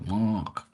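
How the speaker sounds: "Mark" softly, no R, like "Mahk". Shown as a demonstration. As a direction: stretch the vowel of "Mark" and drop the R. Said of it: "They say".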